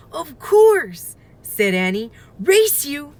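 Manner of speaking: high, loud eager burst; excited. Acted. Annie challenging her brother home, excited shout. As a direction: challenge him to a race.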